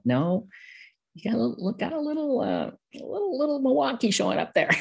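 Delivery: playful high-pitched ribbing voice; teasing voice. Retold or imitated. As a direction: poke fun at the Milwaukee accent. Teasing imitation of an outsider.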